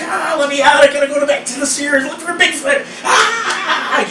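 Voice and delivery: high, wild-man yell to be let out. Retold or imitated. Stuck in elevator, he yells to get out, hammed-up. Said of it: "I'm just like".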